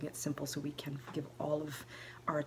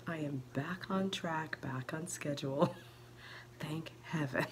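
whispering; 3 semitones higher than usual. Real, not acted. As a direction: whisper so nobody wakes up. Whispered nighttime vlog.

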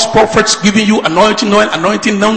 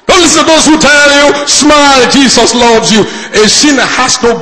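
raised, driving sermon delivery; preaching; 6 semitones higher than usual. Acted. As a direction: preach it with rising urgency. Preaches at full force.

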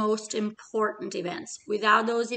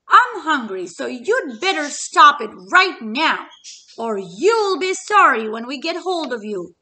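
much louder, higher tough-guy threat; threatening chick; 4 semitones higher, 9 dB louder than usual. Acted. Middle Chick threatening the dog, loud higher blustering.

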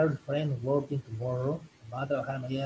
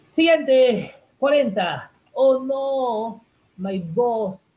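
excited announcing; 7 semitones higher, 8 dB louder, much slower than usual. Acted. Teacher acting out boss scene.

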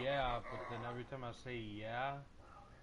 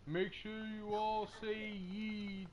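mock politician speech; 6 semitones higher, much slower than usual. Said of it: "imagine me being a politician".

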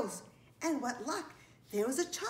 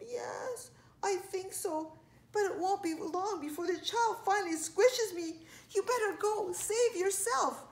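high, pitiful little voice; weakly plead, drawing out the last words; hurt, scared spider; 9 semitones higher than usual. A narrator's reading. Injured spider urges friend to flee.

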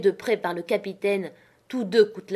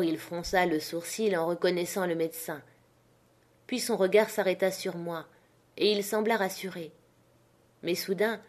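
ordinary delivery, nothing marked

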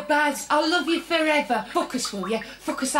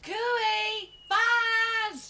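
calling out; 8 semitones higher than usual. Acted. Calling out to Barry from outside.

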